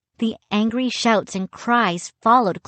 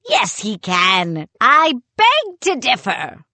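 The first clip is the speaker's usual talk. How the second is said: arguing sailor; 3 semitones higher, 4 dB louder than usual. A narrator's reading. Other head arguing back.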